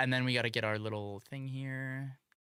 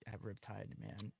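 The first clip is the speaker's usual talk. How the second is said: usual speaking voice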